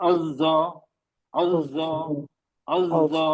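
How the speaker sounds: अज़्ज़ा with the ज़्ज़ buzzing on; buzzing doubled z in अज़्ज़ा; voiced zz flow. Shown as a demonstration. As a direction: let the ज़ of अज़्ज़ा buzz steadily. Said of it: "sound flow हो रहा है".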